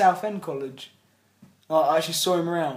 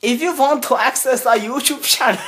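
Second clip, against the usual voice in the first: hammed-up German accent, much higher and louder; loud mock German announcer; 9 semitones higher, 9 dB louder than usual. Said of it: "I've gone from German to Swedish".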